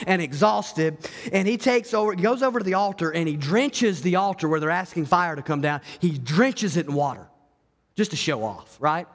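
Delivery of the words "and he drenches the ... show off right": ordinary talk, nothing marked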